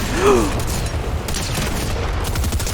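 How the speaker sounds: a sudden, quiet breathless gasp; sharp gasp. Real, not acted. Gamer startled by something on screen, gasping. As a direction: catch your breath in surprise.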